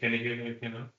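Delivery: very faint, far from the mic; speaking too softly to hear; barely audible. Real, not acted. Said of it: "your voice is very low".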